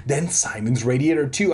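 usual speaking voice

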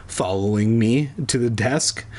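ordinary delivery

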